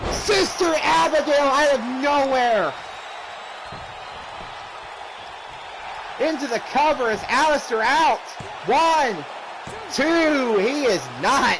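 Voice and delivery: rising shouted count; excited near-fall call. Real, not acted. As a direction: build up the count with the crowd.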